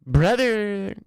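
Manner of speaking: high, loud, drawn-out Brother; Sketch impression. Streamer doing his Sketch impression for chat, high and loud. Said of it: "That's me doing my Sketch impression".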